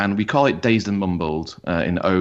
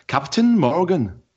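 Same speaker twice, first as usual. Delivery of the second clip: Captain Morgan in a high, slow, hammed-up Welsh accent; bad Welsh accent; 9 semitones higher, 3 dB louder, slower than usual. Shown as a demonstration. Podcast host doing a bad Welsh accent for his co-host. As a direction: drag out Captain Morgan in a mock Welsh lilt. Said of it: "That's not a good Welsh accent".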